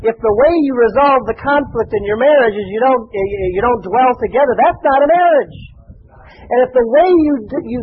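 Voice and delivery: ordinary talk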